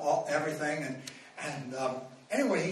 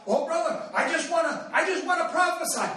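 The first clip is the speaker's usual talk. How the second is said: mock eager plea; 10 semitones higher, 4 dB louder than usual. Mocking eager would-be prophet.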